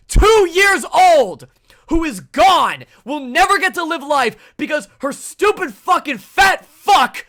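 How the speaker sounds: loud, furious shouting; livid rant. Real, not acted. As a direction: spit out real rage at the father. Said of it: "I'm fucking livid right now".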